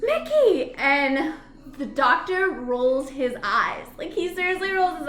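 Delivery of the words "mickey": calling out Mickey. Retold or imitated. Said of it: "she's just like".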